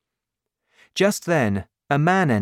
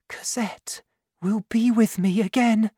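high whispered longing; whispered thought; 10 semitones higher than usual. A narrator's reading. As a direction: breathe her child's name as a secret hope. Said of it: "thought Fantine".

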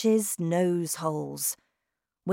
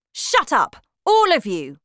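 shouting witch; 8 semitones higher, 10 dB louder than usual. Acted. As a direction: bellow like a terrifying witch queen. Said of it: "the Grand High Witch shouted".

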